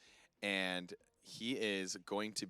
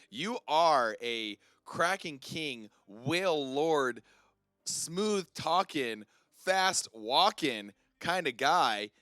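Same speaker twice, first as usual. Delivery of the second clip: slow, loud hype introduction; slow hype announcer; 4 semitones higher, 6 dB louder, much slower than usual. Acted. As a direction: draw out each title like a ring announcer. Host hypes the guest.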